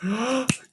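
high, sudden intake of breath; shocked gasp. A narrator's reading. Monkey spotting his suspicious brother, shocked gasp. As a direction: gasp at the discovery.

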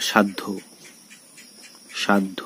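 সাধ্য as "shad-dho"; d-dh sound. Shown as a demonstration. Teacher models the rule, সাধ্য with d-dh. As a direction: close on d, release dh in সাধ্য. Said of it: "দয় ধয়ের মতো".

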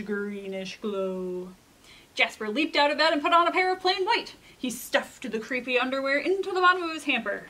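lively picture-book reading with dramatic swings; storytime narration. A narrator's reading. Librarian reading a picture book to kids, storytime voice. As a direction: read it to a group of children, playing up the scary parts.

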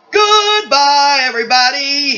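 loud, stretched-out farewell; rousing sign-off. Real, not acted. Performer closing the show to everyone, loud farewell. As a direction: call out goodbye to the whole crowd.

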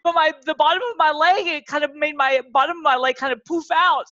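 ordinary talk, nothing marked

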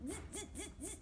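soft, high buzzy monkey noises; mimicked monkey chatter. A narrator's reading. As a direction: chatter back mockingly like monkeys. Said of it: "the monkeys ... and said".

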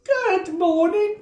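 high, bright greeting; cheerful Missus Bear. Acted. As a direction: sing it out to her sleepy husband. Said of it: "she said".

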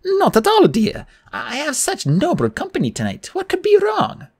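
very high, sweetly innocent hostess; Auntie Em. A narrator's reading. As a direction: coo reassurance in an old lady's voice.

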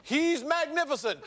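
high, rousing announcer voice; showman read-out. Acted. Host reads ladies' praise to the audience, grand announcer voice. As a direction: stretch the praise out grandly for the crowd.

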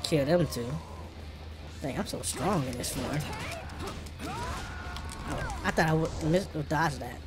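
usual speaking voice

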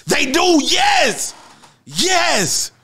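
bursting yes; excited shout. Real, not acted. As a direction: shout it out, thrilled. Excited agreement in group chat.

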